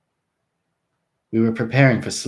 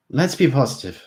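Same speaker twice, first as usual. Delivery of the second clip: 1 upbeat; 6 semitones higher, 8 dB louder than usual. A narrator's reading. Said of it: "I said".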